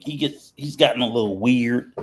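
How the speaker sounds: ordinary talk, nothing marked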